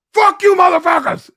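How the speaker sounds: high, loud drunken-heckler imitation; acted taunting shout. Drunk sailor driving by the gate guards, acted taunting shout. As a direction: holler it at guards from a passing car.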